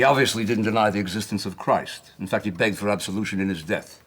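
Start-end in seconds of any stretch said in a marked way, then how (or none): none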